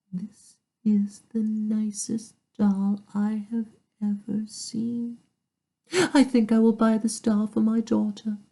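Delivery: dainty lady shopper. A narrator's reading. Second lady admires the doll.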